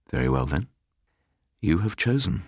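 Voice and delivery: a touch of cold restraint; husband answers wife's outburst, mildly cold; coldly. A narrator's reading. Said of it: "he said coldly".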